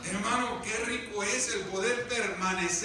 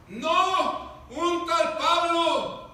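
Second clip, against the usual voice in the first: raised, higher voice calling back; guardia respondiendo; 7 semitones higher, 6 dB louder, much slower than usual. Acted. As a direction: shout the answer back, drawing out the name. Guardia responde a gritos al carcelero. (respuesta a gritos, alargada)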